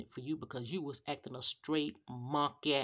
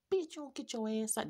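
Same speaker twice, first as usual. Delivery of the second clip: higher, sharp mock telling-off; the comeback she held back, said higher; imagined angry retort; 7 semitones higher than usual. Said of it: "That's what I really want to say".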